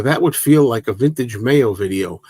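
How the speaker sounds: ordinary delivery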